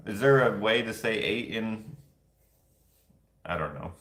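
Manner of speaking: usual speaking voice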